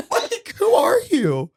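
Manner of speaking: high laughing voice. Real, not acted. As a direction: talk through the laughter. Laughing at the letter.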